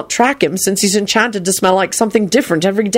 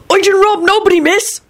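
high-pitched, blurted denial; frantic; 13 semitones higher than usual. A narrator's reading. Thief denying robbery to a girl, frantic. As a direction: protest innocence in a high panic. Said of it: "Robbie blurted out frantically".

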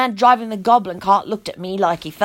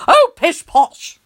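slow, high, louder scoff; rude dismissal; 7 semitones higher, 6 dB louder, slower than usual. Acted. Tony dismisses the girl rudely with a scoff. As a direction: brush her off with a lofty scoff. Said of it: "which was very rude".

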